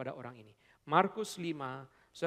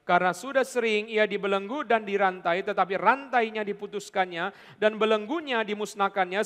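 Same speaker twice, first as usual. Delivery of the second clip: loud, driving Bible reading; dramatic scripture reading; 5 semitones higher, 9 dB louder than usual. Acted. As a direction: read the demoniac's story with force.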